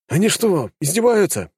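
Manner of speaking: a touch of outrage; indignant. Acted. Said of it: "от возмущения запыхтел Пожарский".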